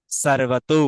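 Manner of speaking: slow 'sarvato' for সর্বতু, with a true 's'; Sanskrit dental s. Shown as a demonstration. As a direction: stretch সর্বতু with a rolled r. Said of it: "স এর মতো হয়ে যায়". (সংস্কৃত উচ্চারণে সর্বতু)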